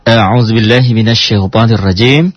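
chanted Quranic recitation; recited ta'awudz. Shown as a demonstration. Teacher intoning the opening before the lesson, chanted.

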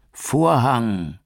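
high, loud long "Vorhang" dropping away; falling scream. A narrator's reading. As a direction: scream the word as he falls. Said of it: "schrie er".

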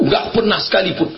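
ordinary talk

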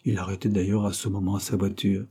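ordinary delivery

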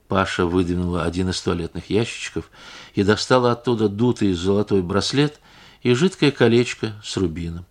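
ordinary delivery, nothing marked

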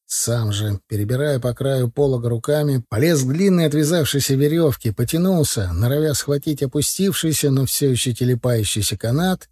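ordinary delivery

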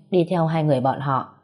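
plain reading voice

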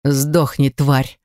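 plain reading voice

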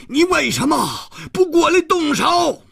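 very high, furious yelled challenge; angry roar. Acted. Hero demanding that the hidden killer come and fight, furious roar. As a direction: roar the question out in anger. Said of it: "胡铁花怒吼道".

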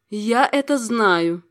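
ordinary delivery, nothing marked

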